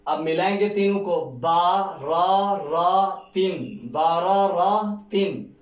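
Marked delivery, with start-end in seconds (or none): 1.3-5.5 very slow, drawn-out blend. Shown as a demonstration. Teacher modelling the joined word, very slowly. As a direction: stretch each syllable. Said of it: "teacher blends syllables slowly".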